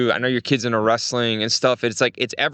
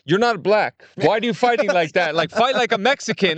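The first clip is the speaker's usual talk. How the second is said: imitating a Russian coach, pitched up; barking it like a Russian trainer; mock Russian coach, higher voice; 6 semitones higher than usual. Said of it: "he was telling me like that".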